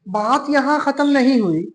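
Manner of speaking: ordinary talk, nothing marked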